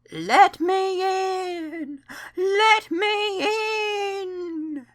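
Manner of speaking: very high, very slow wailing ghost; spooky voice. A narrator's reading. Percy pretending to be a ghost, spooky wail. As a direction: moan it out like a phantom at the door. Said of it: "said Percy in a spooky voice".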